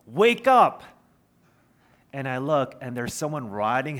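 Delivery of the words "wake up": high, loud warning shout; imitated yell. Cyclist to a man crossing the street, yelling. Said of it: "someone yells".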